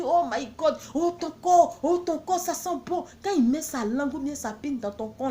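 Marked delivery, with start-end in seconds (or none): 0.0-3.1 higher, gushing lover's moan; mock lover. Woman mimicking an excited lover in bed. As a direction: play the man gushing in bed. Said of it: "même il dit".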